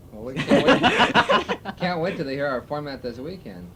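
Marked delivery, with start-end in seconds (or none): 0.1-1.8 laughing through the words, high and slow. Real, not acted. Station manager teasing interviewers about the new format, laughing. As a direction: stretch it out while laughing at the secret.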